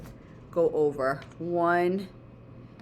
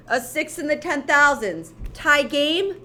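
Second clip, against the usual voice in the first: loud, high tie game call; sportscaster call; 6 semitones higher, 5 dB louder than usual. Pretend. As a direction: call it like a referee. Sportscaster framing of comparison.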